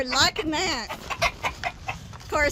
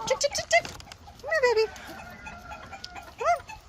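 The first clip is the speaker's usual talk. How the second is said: high soft coaxing call; calling chickens; 6 dB quieter than usual. Real, not acted. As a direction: coax the hens gently, high.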